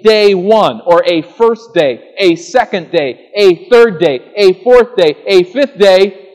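emphatic preaching. Acted. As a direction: drive home each day like a drumbeat. Counting the Hebrew days emphatically.

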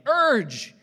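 a high, loud shouted "Urge"; Paul yelling, full of passion. Retold or imitated. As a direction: yell "Urge" like a passionate apostle. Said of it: "if Paul's yelling".

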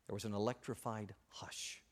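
whispered narration; breathy storyteller hush. A narrator's reading. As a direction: drop to a whisper for suspense. Story tension before leader speaks.

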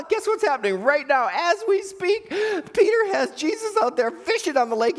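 high-pitched, giggly market gossip; gossiping townsfolk. Acted. Townsfolk at market gossip about Peter, high gossipy voice. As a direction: spread juicy news in a mock high voice.

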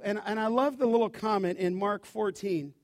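ordinary delivery

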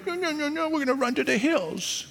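high, small-voiced mock panic; mock-fearful high voice, softened; balking Danites. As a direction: squeak out a timid refusal in a high, soft put-on voice. Mimics the Danites balking in fear.

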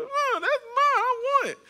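hammed-up whining, crying child; Kylie voice. Four-year-old demanding her tube back, imitated squealing whine. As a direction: wail it in a tiny high kid voice. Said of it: "starts to whine and cry".

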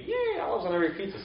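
high, quick cheer of delight; mock delight. A pizza lover cheering the olives, high and quick. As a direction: gush over the olives like a fan. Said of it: "you might be thinking".

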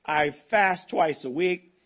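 loud, self-satisfied boasting prayer to God; smug Pharisee. Acted. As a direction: crow about your own goodness. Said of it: "He's full of himself, isn't he?".

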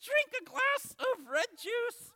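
very high, slow puppet voice; Bones. Pretend. Puppet misreading the verse, high slow squeak. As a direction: draw out a squeaky wrong guess. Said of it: "I'm your ventriloquist".

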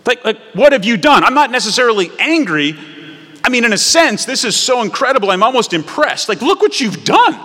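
high, loud, incredulous; a parent staring at the wreckage, half amazed; astonished parent. Acted. Parent to child left alone five minutes, loud and astonished.

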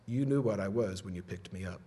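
low, soft snake's reply; knowing rattlesnake. Acted. Rattlesnake answering the boy who saved it, quietly knowing. Snake's punchline after biting threat.

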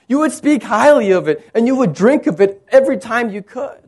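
high, urgent preacher's voice; rousing, insistent sermon climax; full-force preaching. Real, not acted.

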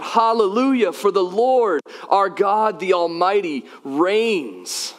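high, ringing proclamation of praise; crying out. Acted. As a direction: cry it out, holding nothing back. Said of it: "They're crying out".

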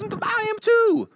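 very high, loud, stretched-out squeal; hammed-up joy at the match; ecstatic agreement. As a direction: stretch it out, beside yourself with delight. Joke: growing delight at match.

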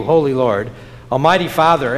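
usual speaking voice

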